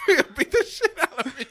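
high, cracking up through the line; losing it at the punchline; laughing hard. Real, not acted.

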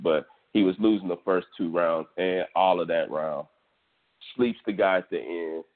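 normal talking voice

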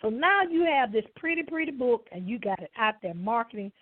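ordinary talk

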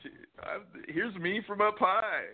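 high, bubbly selfie-poser voice. Retold or imitated. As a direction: play a vain selfie taker, up high.

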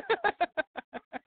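high laugh; high burst of laughter. Real, not acted. Laughing at the joke.

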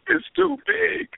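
high, slow imitated wail; mock crying. Man imitating a woman crying during sex, mock crying. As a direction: whine it out as a hammed-up tearful woman. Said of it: "and she starts crying".

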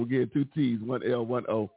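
normal talking voice

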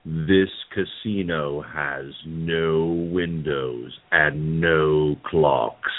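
deep, very slow spooky announcer; slow haunted-house host intoning; Paul Frees ghost host voice. Acted. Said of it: "I'll use Paul Frees's ghost host voice".

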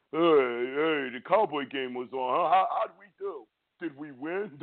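mocking a dopey bandwagon fan; clueless fan voice. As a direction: play the clueless casual fan. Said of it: "Not the one that posts".

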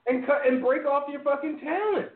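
high-pitched, exasperated telling-off; real high-pitched exasperation; exasperated rant. Real, not acted. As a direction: rant in disbelief, voice pitched well up.

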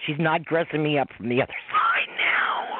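whispered through real laughter; laughing whisper. Real, not acted. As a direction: giggle the words out, barely voiced. Laughing through her own protest.

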